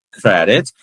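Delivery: ordinary talk, nothing marked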